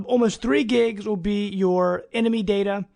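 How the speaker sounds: ordinary delivery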